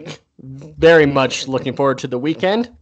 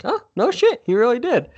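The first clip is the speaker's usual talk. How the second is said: surprised; 9 semitones higher than usual. Retold or imitated. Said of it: "I'm like".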